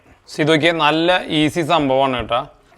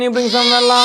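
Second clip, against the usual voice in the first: put-on much higher, voice of a little child; high childlike asking voice; baby voice request; much slower than usual. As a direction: ask as if you were the toddler.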